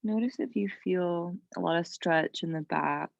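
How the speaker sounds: calm yoga teacher with long, restful pauses; guided meditation. Real, not acted. Yoga teacher calming students in child's pose, guided meditation. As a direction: ease them into rest, unhurried.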